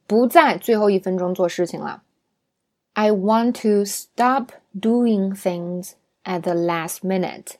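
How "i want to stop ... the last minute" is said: very slow, clear model sentence; slow teaching read. Shown as a demonstration. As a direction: read it slowly for learners. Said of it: "比如说".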